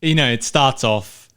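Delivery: ordinary delivery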